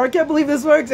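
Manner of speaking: an imitated gasp of disbelief, high; amazed client. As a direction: play the stunned client who can't believe it. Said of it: "and they're like".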